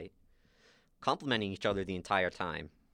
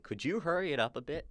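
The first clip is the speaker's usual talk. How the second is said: higher-pitched impatient prod; mock-impatient question, pitched up; 6 semitones higher than usual. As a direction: politely hurry someone along. Inigo impatient with the climber.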